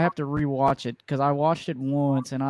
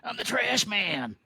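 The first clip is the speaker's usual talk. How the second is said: hint of Danny DeVito as Pikachu, ham it lightly; Danny DeVito impression; 8 semitones higher than usual. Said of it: "I can't do a Danny DeVito accent".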